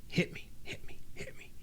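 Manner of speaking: imitated secret whisper; very soft, whispered mimicry; covert whisper. Imitating wrestler's covert cue mid-match.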